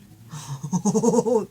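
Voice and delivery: a big real laugh bursting out; loud burst of laughter. Real, not acted. Reader laughing at the card's message, a loud real laugh.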